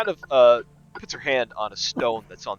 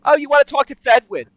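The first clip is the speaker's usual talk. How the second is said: very high, loud receptionist; loud, high-voiced front desk greeting; receptionist character voice; 11 dB louder than usual. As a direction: pipe up loudly, high-pitched. GM plays receptionist Sheila.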